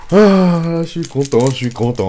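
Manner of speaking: loud, high burst of joy; real excited yelp; excited cheer. Real, not acted. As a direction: shout with delight at the pull. Pulls Starlight from booster.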